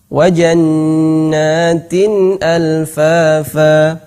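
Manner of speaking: Wa jannatin alfafa slow, nun in tin clear; clear tanwin. Shown as a demonstration. Teacher reciting clear tanwin before hamzah. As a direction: chant Wa jannatin alfafa without lengthening. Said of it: "jelas nunnya".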